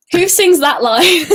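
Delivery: laughing. Real, not acted. Laughs after her singing.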